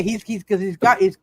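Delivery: ordinary delivery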